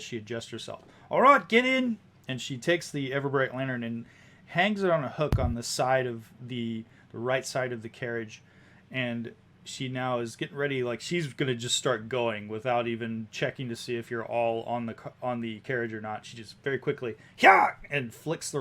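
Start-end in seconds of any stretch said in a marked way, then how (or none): 1.1-2.0 high, loud holler; woman carriage driver. Acted. Cab driver waving passengers in, loud high holler. 17.4-17.7 sharp, loud "hya"; urging horses on. Acted. Driver flicking the reins, sudden loud shout.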